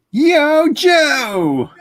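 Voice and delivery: high, loud rallying shout; battle cry. Pretend. Host yelling the G.I. Joe cry to the chat, loud and high. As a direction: belt it out like a cartoon war cry.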